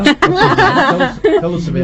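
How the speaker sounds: host teasing a guest for her fiancé's name; playful teasing squeal. Real, not acted. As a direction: squeal the question like a nosy friend.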